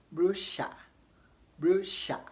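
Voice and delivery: brochure said BRU-sha, rhyming with Elisha; British brochure. Shown as a demonstration. Teacher showing students the British brochure, rhyming with Elisha. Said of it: "to rhyme with Elisha".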